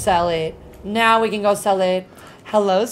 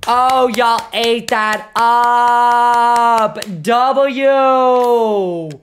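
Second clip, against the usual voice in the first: very long, held-out hype cheer; hyped cheer; 4 dB louder, much slower than usual. Real, not acted. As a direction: hold each big word out like a chant. Cheering on gifters in stream.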